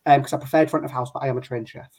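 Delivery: normal talking voice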